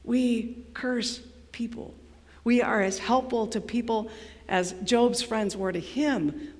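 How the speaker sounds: low, very soft and measured; hushed, grave preaching. Real, not acted. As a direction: let it sink in with long pauses. Preacher drops into hushed gravity.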